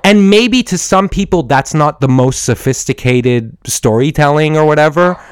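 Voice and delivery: ordinary talk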